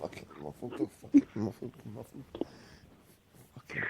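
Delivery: faint, muffled grumbling. Real, not acted. Host grumbling to co-host about the sound, muffled and faint. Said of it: "you went muffled there".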